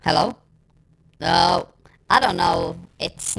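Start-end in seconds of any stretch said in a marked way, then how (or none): none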